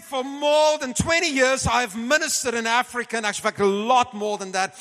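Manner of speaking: high, loud imitation of a fervent preacher; preaching voice. Retelling Reinhard's preaching to him.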